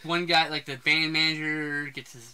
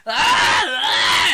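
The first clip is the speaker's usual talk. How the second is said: high, loud pretend-attack yells; hammed-up flailing cries; mock screams; 6 semitones higher, 8 dB louder than usual. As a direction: yell as if fending off a fake creature. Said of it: "pretending something's attacking you".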